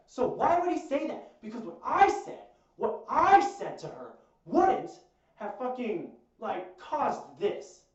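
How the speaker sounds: loud, high-pitched indignant protest; losing it. Retold or imitated. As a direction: argue it hotly, slowing down for emphasis. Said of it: "like, I lost it".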